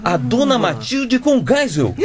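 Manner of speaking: normal talking voice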